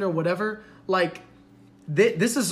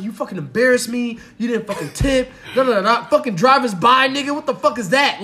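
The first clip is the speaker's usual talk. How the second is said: raised, heated telling-off; ripping into him, imitated; 5 semitones higher, 7 dB louder than usual. Woman scolding date out at the car, building angry mock rant. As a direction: tear into him in acted outrage, building louder. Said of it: "she rips him a new one".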